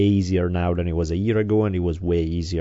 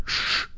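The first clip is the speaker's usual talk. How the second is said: long soft shush; 6 dB quieter than usual. Retold or imitated. Child silencing an explaining parent, firm shush. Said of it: "She'll just shush me".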